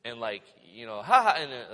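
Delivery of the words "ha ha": high, loud fake "ha ha"; mock laugh. Someone laughing before they suddenly get sober, mock laughing. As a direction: imitate someone laughing, briefly and loudly. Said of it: "they're laughing".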